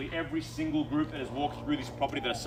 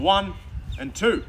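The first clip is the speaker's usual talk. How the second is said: facetious; 6 semitones higher, 6 dB louder, much slower than usual. Pretend. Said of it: "Just being facetious".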